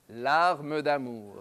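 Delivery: L'arme d'amour drawn out slowly; very slow emphasis. Shown as a demonstration.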